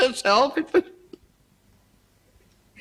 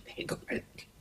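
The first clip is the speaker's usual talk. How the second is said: very soft, barely voiced whisper; 20 dB quieter, much faster than usual. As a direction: breathe it out almost silently. Cook answers, spent and quiet.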